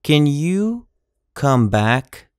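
ordinary delivery

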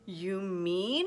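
gasped question from an astonished elephant. A narrator's reading. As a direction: ask it amazed, voice climbing. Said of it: "Horton gasped".

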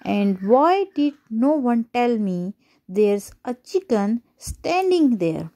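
usual speaking voice

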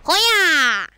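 stretched, strange put-on voice calling out; odd put-on call. Gamer taunting his opponent, calling out in a silly put-on voice.

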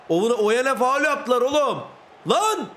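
high outcry ending in a sharp yell; indignant protest. Real, not acted. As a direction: protest the foul to your mates. Protests a foul. (isyan edercesine, yüksek sesle)

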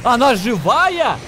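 удивлённо. Real, not acted. Sudden shock at game event.

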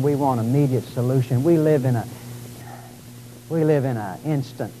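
ordinary talk, nothing marked

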